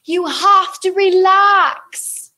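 high, drawn-out character impression; impersonation. Acting out Hermione in the Devil's Snare, high drawn-out impersonation. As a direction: stretch it out high in a put-on character voice. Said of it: "That's a brilliant impersonation".

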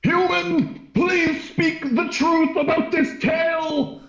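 high, formal alien voice; Xanthorpe speaking. Acted. Xanthorpe demands truth from the human, high formal voice. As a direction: lift it up high and stiff. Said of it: "And he says".

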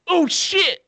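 high, loud startled curse; alarmed yelp. Retold or imitated. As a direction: caught off guard in the dark. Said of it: "And he went".

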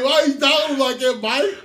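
high, loud mock whine; retold wounded protest. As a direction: plead it like a scolded employee. Retold hurt protest to boss.

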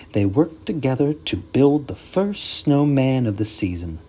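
plain reading voice

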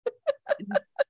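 high-pitched giggle; high squeaky laugh. Real, not acted. Reacting to robot line.

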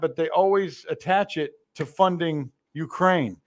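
ordinary delivery

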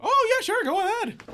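high, loud squeal of keenness; mock eagerness. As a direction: jump at the offer in an over-eager high voice. Mock-eager reply to offered example.

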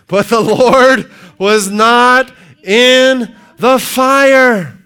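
high, loud, slow scripture reading; preaching scripture. Acted. Preacher reading First Kings aloud at full force. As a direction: thunder out the verse.

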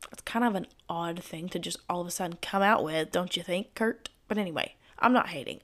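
ordinary talk, nothing marked

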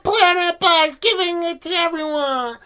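high, slow, hammed-up cheer; mock cheering crowd. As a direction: gush like a cheesy movie crowd. Mocking a movie's ending crowd.

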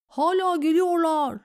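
high, loud alarm call; shouted warning. A narrator's reading. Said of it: "diye bağırdığında". (bağırarak uyarı)

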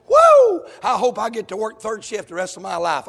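tongue-in-cheek whoop of delight; mock eager worker. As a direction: parody a cheerful night-shift volunteer. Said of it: "never met nobody that says".

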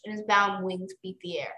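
ordinary delivery

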